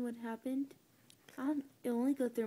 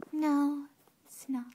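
normal talking voice

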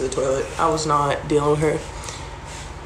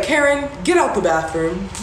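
dismissive; 3 semitones higher than usual. Retold or imitated. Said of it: "I was like".

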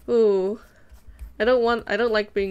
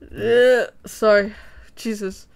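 usual speaking voice

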